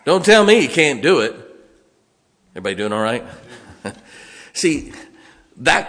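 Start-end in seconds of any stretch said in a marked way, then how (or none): none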